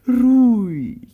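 high, loud burst on the word; loud mocking echo; exasperated outburst. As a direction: throw the word back loudly.

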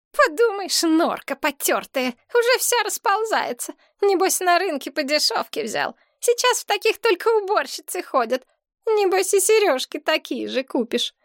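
high, squeaky sneering complainer; scornful girlfriend. A narrator's reading. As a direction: sneer at his gifts in a high girlish voice. (презрительно, писклявым девичьим голосом)

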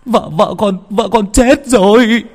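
high, loud, slow wail breaking into tears; tearful panic. Acted. Son telling mother his wife is dead, wailing. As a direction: cry it out to mother. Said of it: "hốt hoảng, đôi mắt rưng rưng".

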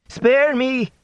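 high-pitched terrified plea; frightened high cry. A narrator's reading. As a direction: beg for mercy, pitch way up. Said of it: "he cried".

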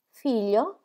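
a startled "Figlio?" rising high at the end; surprised. Acted. Molly hearing of his son, surprised "Figlio?". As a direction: catch the word "Figlio?" in sudden surprise. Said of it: "esclamò Molly, sorpresa".

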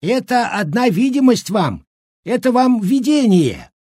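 put-on very high old man's excuse; wheedling old trickster. A narrator's reading. Old cook trying to outwit the women, wheedling. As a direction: try to bluff them in a high voice. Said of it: "пробовал схитрить щукарь".

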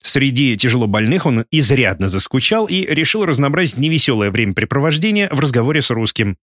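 usual speaking voice

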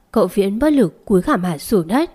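plain reading voice

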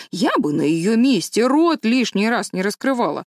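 opinionated matron, swooping emphatic pitch; scandalised busybody holding forth; indignant village gossip. Acted. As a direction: rant about the neighbours with relish.

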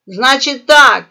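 loud, firm angry opener; angry captain. A narrator's reading. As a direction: lay down the law angrily. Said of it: "зло зацедил чёрный капитан". (зло и громко)

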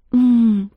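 a high, dreamy hum. Acted. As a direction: hum dreamily, lost in memories. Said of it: "И мечтательно закатил глаза". (мечтательное мычание)

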